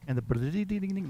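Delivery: high sung ding-ding jingle imitation; Mario one-up sound. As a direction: chime it out like a game power-up. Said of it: "like the Mario one-up sound".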